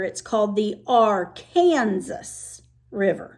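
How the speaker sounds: "Arkansas" said ar-KAN-zas; "Arkansas" with Kansas in the middle; Kansas pronunciation. Shown as a demonstration. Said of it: "But when it's in Kansas".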